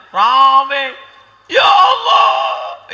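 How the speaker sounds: high, loud hammed-up cry of Ya Allah; mock wailing. Dhikr crowd wailing, loud high mock cry.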